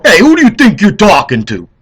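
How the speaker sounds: loud, high mock confrontation; angry retort. Guy talking down to him, loud indignant retort. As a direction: snap back at the rude guy. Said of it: "You go".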